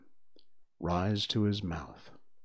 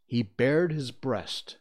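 ordinary delivery, nothing marked

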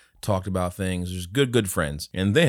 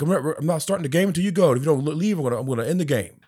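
higher, fast ultimatum; ref threatening; 8 semitones higher, faster than usual. Retold or imitated. Referee warning the dad, fast high voice. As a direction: rattle off the threat. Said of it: "He's like".

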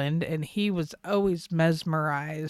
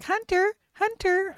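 high-pitched imitation of a girl calling out; mock hollering girl; 13 semitones higher than usual. Imitating a girl calling to someone across the paddock, mock hollering. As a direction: put on a much higher attention-seeking holler. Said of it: "one of the girls it was hollering".

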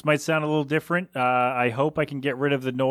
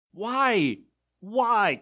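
baffled why; 7 semitones higher than usual. Real, not acted. Exasperated rant at the customer.

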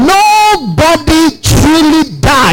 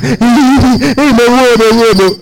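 mock crying wail. Preacher imitating a dead person crying, mock wailing. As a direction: wail like a pretend weeper. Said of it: "the person is crying".

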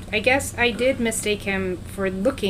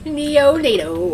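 playful nickname voice; 5 semitones higher, 3 dB louder, much slower than usual. Pretend.

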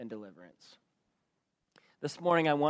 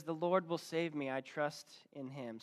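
fading weaker, slowing toward the end; a tired man running out of strength; losing energy; 7 dB quieter than usual. Retold or imitated. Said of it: "beginning to lose energy".

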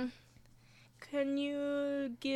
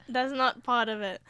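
ordinary talk

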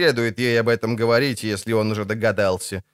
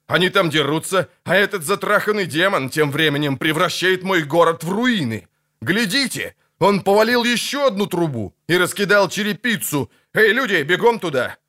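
raised pitch, indignant tirade; rant, then call out orders; worked-up, higher-pitched complaint; agitated outrage; 7 semitones higher than usual. Acted. Angry mayor orders people to the damage.

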